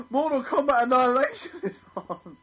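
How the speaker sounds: gasping, thrilled, loud and high; excited gasp. Retold or imitated. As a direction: blurt it out in sudden delight. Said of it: "I was like".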